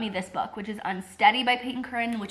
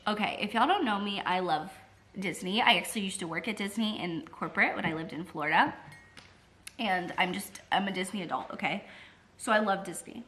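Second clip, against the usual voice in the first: ordinary talk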